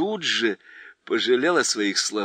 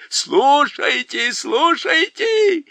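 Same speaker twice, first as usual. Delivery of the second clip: very high, slow hooting cry; shouting creatures; 8 dB louder, much slower than usual. A narrator's reading. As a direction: bellow like a foolish crowd. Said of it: "закричали однотопы". (громкий хор, очень высоко, медленно)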